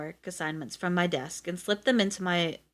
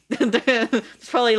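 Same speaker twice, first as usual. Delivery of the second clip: laughing, higher and louder, slow; 5 semitones higher, 6 dB louder, slower than usual. Real, not acted. Reader cracking up at his own joke, laughing. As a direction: laugh through it.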